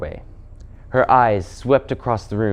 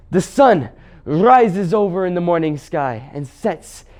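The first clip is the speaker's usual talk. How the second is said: Puddleglum the Marshwiggle; 5 semitones higher than usual. Acted. Marshwiggle still fighting hard against enchantment, defiant. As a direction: fight off the spell word by word.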